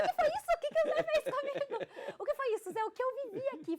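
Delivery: high, loud, laughing outburst; stunned disbelief. Retold or imitated. Meditator telling of coming out of two hours, stunned and loud. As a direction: exclaim in giddy shock, voice shooting up. Acts out shocked reaction after meditation.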